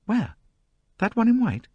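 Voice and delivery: high, eager question; eager surprise. A narrator's reading. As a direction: lean in curious. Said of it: "her sulkiness quite dropped".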